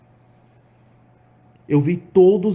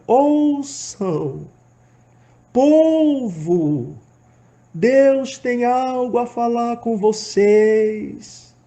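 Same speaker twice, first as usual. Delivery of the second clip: high, slow, loud calling out to the crowd; desperate shout; 8 semitones higher, 4 dB louder, much slower than usual. Retold or imitated. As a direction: call out to a crowd that won't listen. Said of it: "saltar e gritar". (grito desesperado, agudo e lento)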